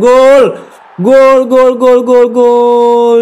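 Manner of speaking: long, drawn-out goal yell; goal celebration shout. Real, not acted. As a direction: belt out the goal call. Goal celebration in football game.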